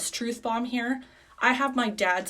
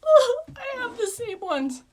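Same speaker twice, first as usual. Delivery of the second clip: high, loud, slow mock sob; fake-wail it, hammed-up; mock cry; 5 dB louder, slower than usual. To her dad about shared eyelashes, high mock sob. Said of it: "I'm like".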